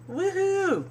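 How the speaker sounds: high, hammed-up whoop; mock cheer. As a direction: squeal it like a party. Cheering the reunion.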